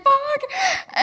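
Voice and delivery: very high-pitched mock panic squeal; retold freak-out. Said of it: "I'm having this freak out".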